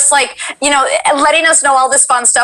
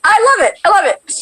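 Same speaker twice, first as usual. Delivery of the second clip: high, gushing love for it; excited delight; 9 semitones higher than usual. Acted. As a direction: gush in a high voice. Acting out delight at fan art.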